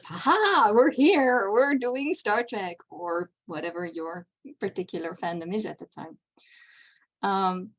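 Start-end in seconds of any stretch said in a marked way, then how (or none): 0.0-2.8 louder and higher, a gleeful cheer that slows down at the end; giddy fan glee. Retold or imitated. The reader feels fandom delight, voiced as a giddy higher cheer. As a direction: burst out like a thrilled reader spotting the canon. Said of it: "gives the reader the sense of".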